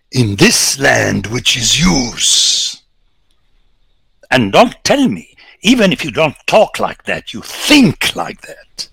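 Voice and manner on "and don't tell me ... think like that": higher and louder, slowing at the end; a rising rebuke to the listeners; emphatic challenge. Real, not acted. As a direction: confront the listeners directly. Preacher confronts audience.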